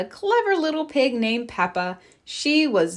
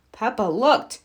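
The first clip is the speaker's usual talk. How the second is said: ordinary delivery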